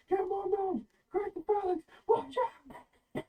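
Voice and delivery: high, loud battle orders; guards shouting. Acted. Guards calling out in panic, high and loud. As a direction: yell orders over the chaos.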